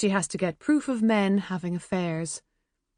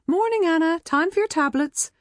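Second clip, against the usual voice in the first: mum's voice; 11 semitones higher, 4 dB louder than usual. A narrator's reading. Mum voiced in the story.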